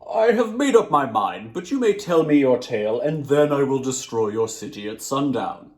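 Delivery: loud, lightly voiced desert djinn; a giant grudgingly giving in; djinn's voice. Djinn grants the boy a tale, loud and lofty.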